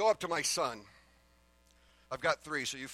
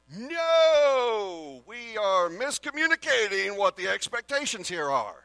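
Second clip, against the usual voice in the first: louder, higher exasperated correction; exasperated dad; 7 semitones higher, 10 dB louder, slower than usual. Retold or imitated. Father scolding son over the unclean bathroom, loud and exasperated. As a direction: let the frustration out at the kid.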